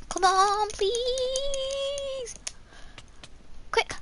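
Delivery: high urgent begging; pleading urgently. Real, not acted. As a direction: beg the game, pitch rising. Urging the game.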